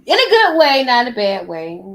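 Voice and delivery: high, loud "good way"; excited clarification. Real, not acted. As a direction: jump in eagerly. Quickly clarifying with a laugh.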